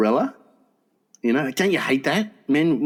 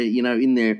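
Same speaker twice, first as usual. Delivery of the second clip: normal talking voice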